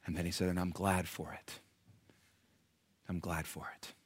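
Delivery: quiet, low retelling of a friend's words; hushed, heartfelt. Retold or imitated. Preacher quoting a pastor friend's gratitude, hushed and heartfelt.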